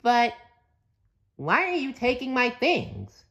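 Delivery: high cartoon sponge, puzzled; squeaky high SpongeBob question. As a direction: ask it as a confused SpongeBob. SpongeBob voiced in story.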